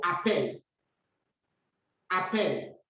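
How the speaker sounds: "appel" with the stress on the last syllable; French example word. Shown as a demonstration. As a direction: say "appel" softly and clearly.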